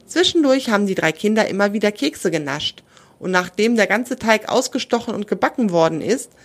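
ordinary delivery, nothing marked

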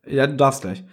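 ordinary delivery

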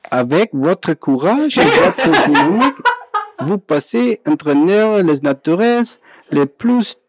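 high, laughing mock-French reading; laughing through French. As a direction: giggle through the French words. Reads fortune cookie in French. (lachend auf Französisch vorgelesen)

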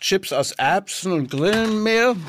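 talking through a mouthful; chewing while speaking; mouth full. Real, not acted. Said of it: "mit vollem Mund sprechen darf". (mit vollem Mund gesprochen)

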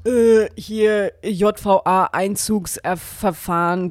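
slow, loud bureaucratic read; slow official reading. Acted. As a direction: drag it out like a form letter.

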